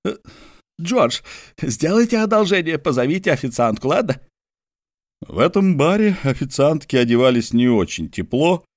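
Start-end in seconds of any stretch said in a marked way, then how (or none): 0.1-4.2 higher, tired plea to the narrator; lightly voiced weary friend asking a favour; Mordecai's weary voice. A narrator's reading. Tired Mordecai asks George to call the waitress, higher voice.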